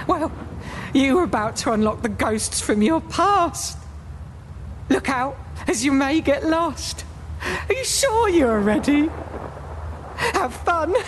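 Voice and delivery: high sinister taunting voice; creepy ghost host teasing; spooky host. Acted. Spooky host warns visitor.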